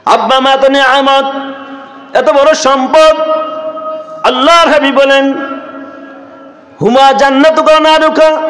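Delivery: high, ringing sermon chant; intoned high preaching in separate phrases; full-force preaching. Real, not acted. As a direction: proclaim it to the crowd on a high held note.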